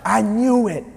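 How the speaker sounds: imitating a doctor's loud announcement. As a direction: call it out like the delivering doctor. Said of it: "the doctor says real loud".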